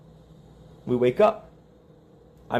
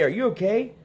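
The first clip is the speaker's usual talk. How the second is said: higher, lightly acted worried questioning; mock concern; 7 semitones higher, faster than usual. What the lawyer didn't ask the wife, mock concern. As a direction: lift it into an incredulous check. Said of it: "He didn't say".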